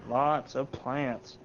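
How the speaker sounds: drawn-out, very slow emphasis; slow knowing repeat; slow deliberate repeat. Real, not acted. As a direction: stretch each word out slowly. Repeats the phrase for effect.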